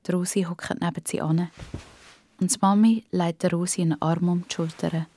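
usual speaking voice

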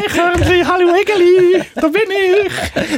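very high, slow squeaky sing-song; squeaky fairy-tale voice. Pretend. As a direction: chirp like a cartoon forest creature. Pretends greeting forest animals naked.

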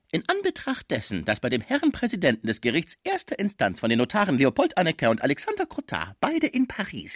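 high-pitched, formal recitation; reading a legal document. A narrator's reading. Lawyer reads the document out to Madame, high formal recitation.

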